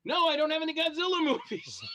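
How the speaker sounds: mock exasperation at the Godzilla question; exasperated retort. Actor retelling the Godzilla fan, laughing exasperated no. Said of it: "I'm like".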